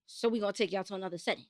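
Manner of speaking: soft, fast scene-setting; hushed storyteller setup. Acted. Setting the scene for listeners, hushed and quick. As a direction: set the scene like a narrator. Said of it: "I'm good at reenacting shit".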